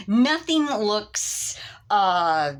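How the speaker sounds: ordinary talk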